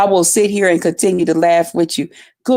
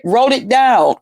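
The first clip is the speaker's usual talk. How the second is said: usual speaking voice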